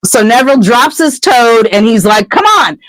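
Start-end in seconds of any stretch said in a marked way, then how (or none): 2.3-2.8 high, brave little challenge. Retold or imitated. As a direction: puff up and dare them to hit. Neville defiantly squaring up to friends.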